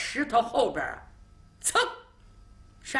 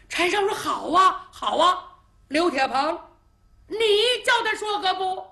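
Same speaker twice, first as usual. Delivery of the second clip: put-on child's voice; 8 semitones higher, 4 dB louder than usual. Child boasts about mediating.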